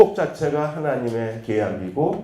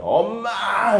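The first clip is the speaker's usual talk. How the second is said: high startled cry for mom; child cries out for mother at the mess; shocked call; 10 semitones higher, much slower than usual. Retold or imitated. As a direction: yell for mom in dismay.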